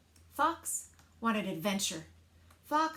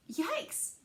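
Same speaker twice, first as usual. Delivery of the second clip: higher startled yelp; frightened cry; 8 semitones higher than usual. A narrator's reading. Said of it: "cried Froggy".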